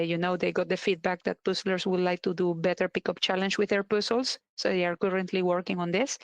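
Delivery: normal talking voice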